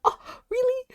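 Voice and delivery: normal talking voice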